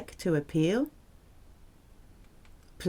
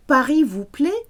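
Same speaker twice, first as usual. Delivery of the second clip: higher, louder rising questions; asking question; 5 semitones higher, 6 dB louder than usual. Shown as a demonstration. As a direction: offer each question brightly to a guest.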